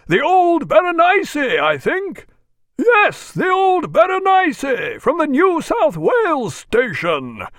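high, slow, carrying hail; stretched-out high shout cutting through hubbub; calling from aloft. A narrator's reading. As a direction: call each word down from the masthead. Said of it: "cutting through the unworthy hubbub".

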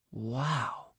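very soft, awed murmur; hushed wonder. A narrator's reading. Pupil awed by the Force, hushed. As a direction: breathe it quietly to yourself. Awed thought, after failing to say wow.

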